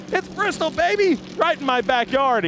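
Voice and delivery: high-pitched, hyped-up race-day cheer; excited hype. Real, not acted. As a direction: belt it out high, pumping up the fans. Hyped shout-out to a fan.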